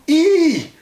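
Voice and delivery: piercing high shriek of fright; girls' shriek. A narrator's reading. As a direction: shriek like you've seen a ghost. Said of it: "The girls screamed again".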